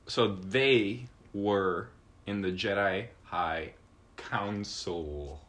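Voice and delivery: deliberate delivery. Real, not acted. Player trying not to laugh at the line, slow and careful. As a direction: draw each word out to hold back laughter.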